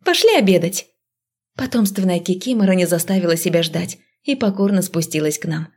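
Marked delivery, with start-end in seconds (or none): 0.0-0.9 calling up the stairs; calling kids to dinner. Acted. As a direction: call her to come eat. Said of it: "громко позвала я".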